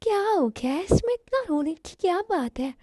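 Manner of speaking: acted bewilderment; baffled concern. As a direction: ask why all the tears. Reacting to her tearful news.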